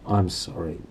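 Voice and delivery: ordinary delivery, nothing marked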